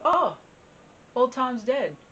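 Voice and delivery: high, loud, exclaimed reaction; cat's sudden realization. Acted. Old house cat hearing the farmer's news, exclaimed surprise.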